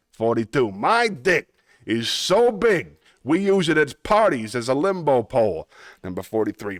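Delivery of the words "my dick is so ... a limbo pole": cartoon Brooklyn wiseguy accent; Brooklyn tough guy. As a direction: boast it like a Looney Tunes Brooklyn tough guy. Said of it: "Yes, it's a Brooklyn accent".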